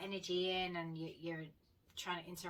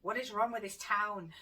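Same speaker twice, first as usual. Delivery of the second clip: a louder, incredulous what is wrong with this town; louder disbelieving rant at the town; exasperated outburst; 3 semitones higher, 7 dB louder than usual. Retold or imitated. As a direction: let the frustration burst out louder on wrong. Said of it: "And I'm like".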